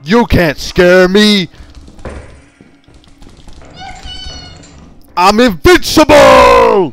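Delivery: loud, slow taunting yell; defiant shout. Pretend. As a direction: bellow that nothing scares you. Taunting the monster in game.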